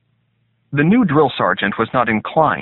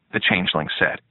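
plain reading voice

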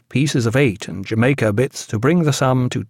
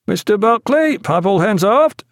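high called-out command; officer hailing the boatswain; passing on an order; 10 semitones higher, 3 dB louder than usual. A narrator's reading. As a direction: call it across the deck, pitched high.

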